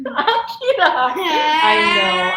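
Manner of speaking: laughing out his name. Real, not acted. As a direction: laugh through it with her son. Mom laughing with interrupting child.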